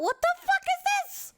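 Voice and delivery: shrieking what the fuck; incredulous shriek. Real, not acted. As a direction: squeal in disbelief at the screen. Escalating disbelief.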